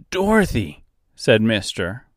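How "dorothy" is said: high, urgent call with rising alarm; growing panic. Acted. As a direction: call her name as panic sets in. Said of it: "with growing panic in his voice".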